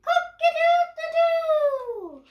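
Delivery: loud, high, slow rooster call; rooster crow. Acted. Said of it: "and shout".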